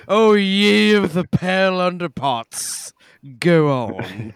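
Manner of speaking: hammed-up slow Southern preacher voice; mock-grand Southern declaration; Southern accent. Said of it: "Underparts definitely works Southern".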